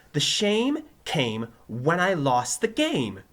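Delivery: loud, slow, high proclamation; crying out. A narrator's reading. Pupil proving himself to teacher, crying out. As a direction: proclaim it slowly and proudly. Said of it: "Joey cried".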